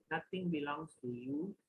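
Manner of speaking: ordinary talk, nothing marked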